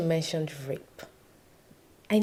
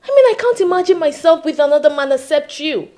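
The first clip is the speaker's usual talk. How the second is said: loud, high, insistent protest; indignant protest; 8 semitones higher, 12 dB louder than usual. Acted. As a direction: raise the voice in heated disbelief. Wife protests offer of another man.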